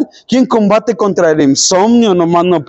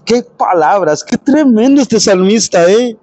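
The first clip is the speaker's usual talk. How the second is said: normal talking voice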